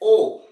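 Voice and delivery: ओ called out loud as a long open o; ओ को ज़ोर से खींचकर बोलो; vowel sound shown. Shown as a demonstration. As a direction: stretch ओ out for the class. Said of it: "ऑनेस्ट।".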